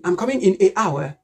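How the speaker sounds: "an" said as "ay" before "hour", no N; wrong article demo. Shown as a demonstration. Student wrongly telling Peter, "an" mispronounced as "ay". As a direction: put a separate "ay" in place of "an" before "hour". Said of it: "So don't say".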